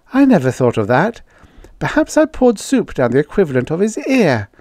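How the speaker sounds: put-on high girlish voice, lively tune; bossy girl thinking aloud; speculating girl. A narrator's reading. Judy speculates to Jane about the alien, undaunted.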